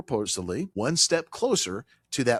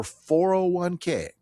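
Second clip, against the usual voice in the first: "four oh one K" drawn out slowly and higher; slow, deliberate stress on every word of "four oh one K"; emphasis on "four oh one K"; 7 semitones higher, much slower than usual. Real, not acted. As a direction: lean hard on each part of "four oh one K". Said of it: "notice how I'm emphasizing".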